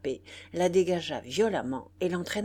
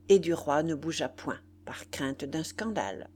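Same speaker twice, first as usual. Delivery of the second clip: plain reading voice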